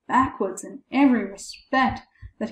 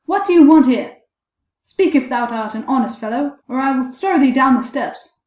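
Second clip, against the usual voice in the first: loud, higher challenge that eases then rises; crying out; 4 semitones higher, 7 dB louder than usual. A narrator's reading. Boy challenging the silent ghost a second time, crying out. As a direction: call out a threat. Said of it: "The boy cried a second time".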